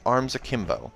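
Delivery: ordinary delivery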